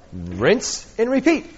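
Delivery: slow, drawn-out rinse and repeat; mock ad slogan, slow; playful slogan. Real, not acted. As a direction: stretch it like a shampoo ad line. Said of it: "It's like the shampoo bottle".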